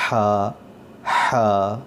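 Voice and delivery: ہا breathed from the middle of the throat with pressure. Shown as a demonstration. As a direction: push air from the throat for ہا. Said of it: "حلق سے آواز آنی چاہیے". (حلق سے دباؤ کے ساتھ ہا)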